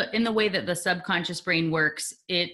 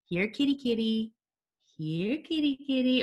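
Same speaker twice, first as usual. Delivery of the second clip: calling a cat; 7 semitones higher than usual. Retold or imitated. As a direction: coax a cat out gently, lightly imitating a friend. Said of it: "this is my best Nikki Rausch impression".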